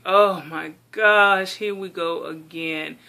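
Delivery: slow, drawn-out mock exasperation; exasperated. Daughter facing mom's criticism yet again, exasperated. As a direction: stretch it out, loud then dropping off, fed up. Retold exasperated reply to mom.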